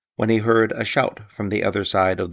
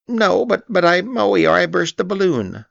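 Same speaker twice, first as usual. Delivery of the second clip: high squeaky stammer, rushing; nervous little pig tripping over his words; flustered Piglet; 11 semitones higher, 6 dB louder than usual. A narrator's reading. Piglet confessing to Eeyore, stammering high and fast.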